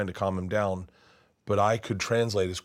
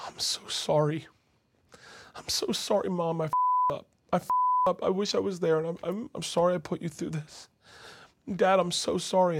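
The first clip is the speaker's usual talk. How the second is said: high, thin, tearful pleading; choked-up apology; 9 semitones higher than usual. Acted. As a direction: apologise through rising tears. Tearful apology to parents, moved teller.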